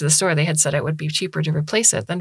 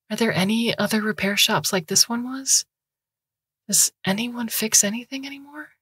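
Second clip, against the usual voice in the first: put-on higher, desperate young woman begging for help; pleading student; 6 semitones higher than usual. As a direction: beg the doctor, voice rising. Han asking the cop, high pitch.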